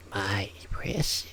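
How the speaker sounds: slow whispered creepy hiss; Smeagol impression. Shown as a demonstration. Trying out a Smeagol impression for ASMR, in a slow whisper. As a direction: creep it out like a hoarding creature. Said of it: "If I wanted to make a Smeagol impression".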